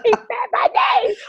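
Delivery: high, drawn-out starstruck squeal; fangirl squeal. Acted. Fan hearing Oprah say their name live, hammed-up fangirl squeal. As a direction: gush slowly like an overwhelmed fan. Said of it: "fangirl moment".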